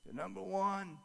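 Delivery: a weak man after heart surgery, barely there; dad's little voice. Retold or imitated. Frail father speaking from hospital bed, tiny soft voice. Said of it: "he had this little voice".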